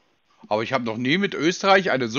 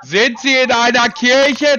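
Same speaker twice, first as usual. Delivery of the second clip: "Kirche" called out slowly and loudly over a bad line; 7 semitones higher, 6 dB louder, slower than usual. Real, not acted. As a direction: raise the voice and stretch each word of "sind Sie in einer Kirche?". Wiederholt bei schlechter Verbindung. (laut und langsam wiederholt)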